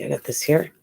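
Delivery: ordinary talk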